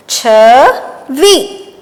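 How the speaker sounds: very slow and drawn out; slow dictation. Shown as a demonstration. Teacher revising dictation words, very slowly. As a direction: spell it out slowly. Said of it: "चलिए अब हम इन्हें फिर से दोहराते हैं।".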